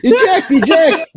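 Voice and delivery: high, slow squeaky alarm; Muppet panic. Acted. Host acting a Muppet pilot, high slow cry. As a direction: draw it out like a panicked puppet.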